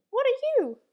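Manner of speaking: a tiny startled creature calling out; small creature voice. A narrator's reading. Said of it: "asked the small creature".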